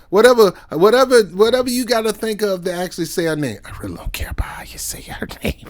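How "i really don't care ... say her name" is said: whispered aside with a laugh in it. Real, not acted. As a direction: drop to a breathy whisper.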